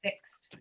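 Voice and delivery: FIXED pronounced "fikst", soft, voiceless end. Shown as a demonstration. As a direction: end "fixed" on a light airy t. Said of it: "but we say fixed".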